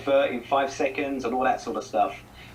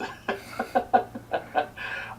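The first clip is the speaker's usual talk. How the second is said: a quiet breathy chuckle; soft laugh; 3 dB quieter than usual. Real, not acted. Guest chuckling at own joke about the accent.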